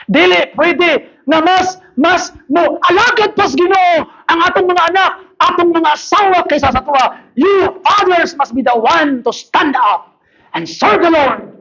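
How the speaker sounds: high, driving pulpit exhortation; preach. Real, not acted. Pastor charging fathers to lead their families, forceful preaching.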